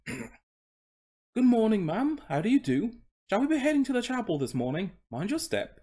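high, put-on polite greeting; courteous coach horse. Horse greeting a lady passenger, high courteous voice. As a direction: play the obliging coach servant in a high voice.

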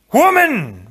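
high, loud, commanding roar. A narrator's reading. Wesley scolds Buttercup, roaring. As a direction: roar it as an order. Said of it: "Wesley roared".

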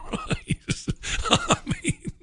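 helpless high, wheezy laughing through words; real laughter. Real, not acted. Host cracking up at absurd prize story, laughing. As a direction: try to talk while cracking up.

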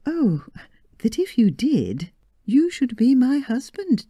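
coy bride. Acted. Bride to husband, coy and high. Marion coyly revealing the bet.

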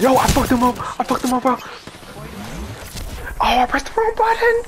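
very high, loud frantic yelling; yelling in frantic dismay; excited high yell. Real, not acted. Excited yelling after mistake.

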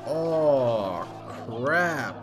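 ordinary talk, nothing marked

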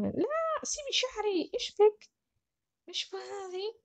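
put-on a girl whining as her hair is pulled; pestered young carer. Child pulls the carer's hair.

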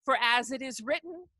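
rhythmic, rolling list-poem reading; poetry performance. Acted. Poet performing his Hallelujah poem for the audience, rolling litany. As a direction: let the names roll like a litany.

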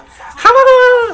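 loud, very high mocking "ha". Imagining a dude running up on you, mock high voice. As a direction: blurt a high-pitched cry, imitating the rapper. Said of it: "having that high-ass voice".

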